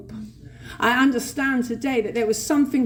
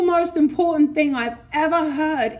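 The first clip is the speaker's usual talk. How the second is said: normal talking voice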